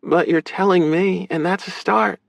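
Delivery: high-pitched sister character voice; accepting, high female voice; supportive sister. Acted. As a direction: play her high, encouraging him.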